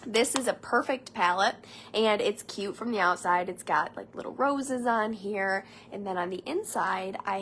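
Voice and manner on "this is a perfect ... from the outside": usual speaking voice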